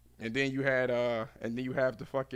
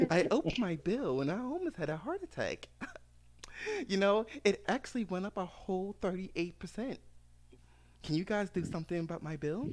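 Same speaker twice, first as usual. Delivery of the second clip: Karen complaining about her bill in a lifted voice; Karen caller impression; 7 semitones higher than usual.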